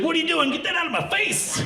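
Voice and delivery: high-pitched mock outrage; indignant girlfriend. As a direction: recoil in a high, scolding voice. Girlfriend recoils in acted quote.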